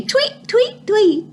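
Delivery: very high chirpy tweets; high-pitched storybook bird calls; birdsong tweets. A narrator's reading. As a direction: imitate little birds. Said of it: "birds in the sky say".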